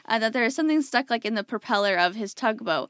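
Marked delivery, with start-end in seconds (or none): none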